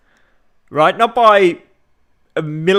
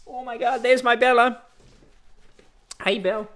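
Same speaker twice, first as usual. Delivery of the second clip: high-pitched, doting coo; cooing greeting; 11 semitones higher than usual. Real, not acted. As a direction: coo a delighted hello to a little darling. Fussing over Bella as she arrives.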